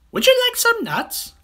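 very high, loud cheeky voice; chirpy monkey. Acted. Monkey greets the crocodile, squeaky and cheeky. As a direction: chatter it up high.

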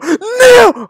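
high, loud scream of NO; frustrated scream. Real, not acted. Gamer failing the jump, frustrated scream. Fails in rage game.

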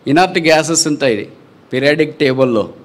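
normal talking voice

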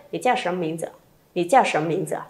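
quick, fluent run-through. Shown as a demonstration. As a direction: rattle it off smoothly. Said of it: "Rồi nhanh nữa lên".

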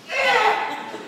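piercing high, loud bird shriek; raven-like shriek. Acted. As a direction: screech it out like a raven. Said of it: "like a, like a raven".

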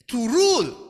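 To rule said high and loud, falling; stress on To rule. Shown as a demonstration. Preacher hammering To rule home to the congregation. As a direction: punch To rule. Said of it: "re-emphasizing".

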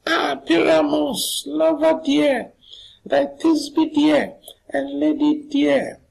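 tiny falsetto, very high and slow; mock falsetto lady voice; monstrous little voice. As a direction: squeak it out in a tiny voice, drawn out. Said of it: "I'll speak in a monstrous little voice".

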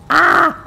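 loud, very high scream; high yelp. Acted. Player rebuffed after saying hi, loud high yelp. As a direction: let out a short, loud squeal. Yelp amid mock quarrel.

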